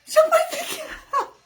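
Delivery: high, fast laughing delivery. Real, not acted. Vlogger laughing as she tells viewers about the cat. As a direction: giggle through it, voice jumping up.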